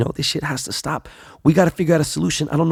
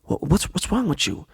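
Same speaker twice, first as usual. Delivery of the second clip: softer, higher imitation of wife; hushed questioning; 5 semitones higher, 6 dB quieter than usual. Wife questions husband while noises are outside, hushed.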